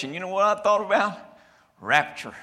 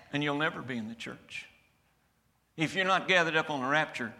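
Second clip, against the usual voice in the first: ordinary talk, nothing marked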